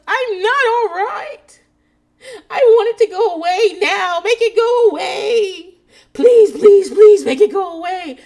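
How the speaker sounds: loud, high, drawn-out fake sobbing plea; mock hysterical wailing. Imitating a woman waking from anesthesia, hammed-up hysterical crying. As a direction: wail it like an over-the-top drama queen. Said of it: "This woman is a drama queen".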